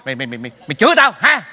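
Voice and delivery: usual speaking voice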